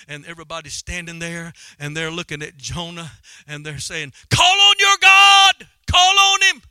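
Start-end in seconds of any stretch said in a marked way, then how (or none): 4.3-6.6 very high, drawn-out yell; preacher acting panicked sailors shouting; crew's desperate shout. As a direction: cry out over a storm. Crew cries out to Jonah.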